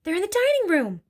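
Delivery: high-pitched, voiced alarm; urgent hiss. A narrator's reading. Mother locates the burglars for the family, hissing urgently. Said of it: "hissed the mother".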